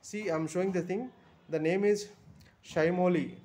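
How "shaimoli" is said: ordinary talk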